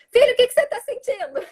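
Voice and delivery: high and loud, exasperated; impatient shouted question. Shown as a demonstration. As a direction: snap the question at the child. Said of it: "Não adianta falar".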